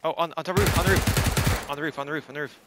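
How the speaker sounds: high, loud urgent warning; urgent callout. Real, not acted. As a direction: shout it fast to your team. Enemy on roof, urgent callout.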